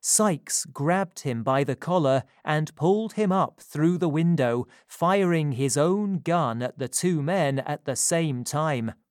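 ordinary delivery

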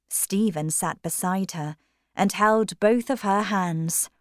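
plain reading voice

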